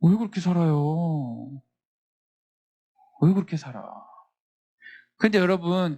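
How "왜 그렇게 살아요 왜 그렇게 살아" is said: mock astonishment. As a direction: act amazed at how they live. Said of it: "깜짝 놀라는 거야".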